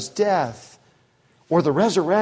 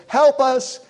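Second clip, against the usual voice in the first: panicked cry; 8 semitones higher, 4 dB louder than usual. Retold or imitated. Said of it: "They wake him up and said".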